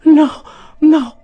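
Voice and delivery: high, loud wailing little cries; feeble hysterical protest. A narrator's reading. Fainting spinster aunt refusing cold water, wailing protest. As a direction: moan a protest in falsetto. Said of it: "mormorò la zia Zittella".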